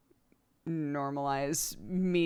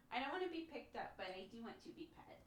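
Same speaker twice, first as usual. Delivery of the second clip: voicing the cat; 5 semitones higher, 17 dB quieter than usual. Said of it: "You say".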